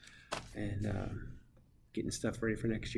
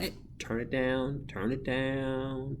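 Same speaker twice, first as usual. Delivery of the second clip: stretched, slow classroom hushing; slow, held-out teacher call; drawn-out teacher plea; 3 semitones higher, much slower than usual. Acted. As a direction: drag out each word to settle the class. Acting teacher hushing a noisy class.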